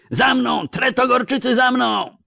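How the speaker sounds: very high battle cry; rallying roar. A narrator's reading. Count seizing the banner to lead the charge, roaring. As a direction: yell for the men to follow. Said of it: "ryknął, wyrywając z rąk chorążego sztandar".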